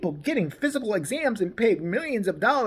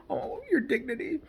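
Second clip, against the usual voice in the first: soft, higher-pitched fake pity; mock sympathy; 5 semitones higher, 9 dB quieter than usual. Critic mocking the athlete's complaint, fake pity. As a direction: coo sarcastically over his hurt feelings. Sarcastic pity after mimicking him.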